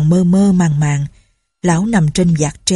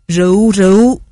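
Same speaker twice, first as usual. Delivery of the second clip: loud, slurred call for more wine; drunken cry for wine; 5 dB louder than usual. A narrator's reading. Drunk groom shouting for more wine, loud. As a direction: bellow for the bottle like a grieving drunk.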